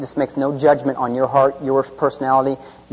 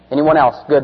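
normal talking voice

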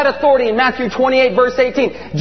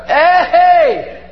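high, whooping shout for attention; excited boys calling out; 6 semitones higher, 3 dB louder, slower than usual. Acted. Disciples come back calling to Jesus, excited shouting. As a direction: holler like boys showing off. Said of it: "Boys are".

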